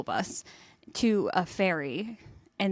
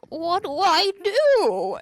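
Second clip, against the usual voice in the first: high, drawn-out mock wail; helpless bus driver; 10 semitones higher, 5 dB louder, much slower than usual. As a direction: imitate a clueless driver, loud and stretched out. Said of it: "he's like".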